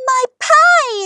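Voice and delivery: high, loud cry of dismay; alarmed exclamation. A narrator's reading. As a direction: yelp it out as if the pie is in danger. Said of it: "exclaimed Grandma".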